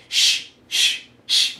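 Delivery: breathy mock shushing; kids shushing. Kids shushing each other in the front row, mock shush. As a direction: imitate kids' shh back and forth. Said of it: "doing this stupid thing".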